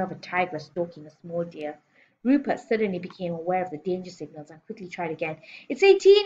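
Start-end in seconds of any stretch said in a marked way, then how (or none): 5.7-6.3 very high, loud schoolboy yelp; panicked cry. A narrator's reading. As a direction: cry out a hurried correction to a menacing teacher. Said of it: "he cried".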